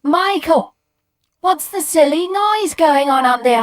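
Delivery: high, loud scolding call; mum shouting upstairs. Acted. Mum calling from downstairs, exasperated shout. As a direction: holler up to a noisy child. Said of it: "my mum from downstairs going".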